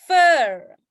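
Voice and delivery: "Fur" loud with a long "er" vowel; pronunciation model. Shown as a demonstration. Teacher drilling fur for students, loud long vowel. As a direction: punch out and hold "Fur". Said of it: "Itu kalau American".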